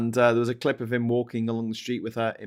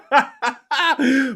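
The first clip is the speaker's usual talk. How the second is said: high, loud gleeful cackle; delighted cackling laugh; 12 semitones higher, 4 dB louder than usual. Real, not acted. Host cracks up at her own jibe, high gleeful laugh. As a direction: cackle at your own joke.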